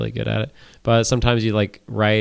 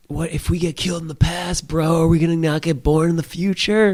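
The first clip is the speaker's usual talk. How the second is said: higher dumb dude voice; 6 semitones higher than usual. Retold or imitated. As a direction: play the clueless turtle. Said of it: "They said".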